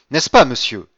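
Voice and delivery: loud, eager outcry; indescribable exclamation. A narrator's reading. Madame de Villefort appealing to the count, exclaiming. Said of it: "s'écria madame de Villefort avec une intonation impossible à décrire".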